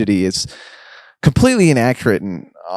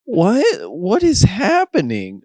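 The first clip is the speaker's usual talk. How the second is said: high, drawn-out waking confusion; slow, high-pitched disbelief; bewildered; 10 semitones higher, slower than usual. Acted. New wakes up to the chaos, bewildered.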